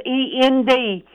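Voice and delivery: "E N D" spelled letter by letter; "E N D" as three separate letters. Shown as a demonstration. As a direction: spell "E N D" one letter at a time. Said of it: "Three letters".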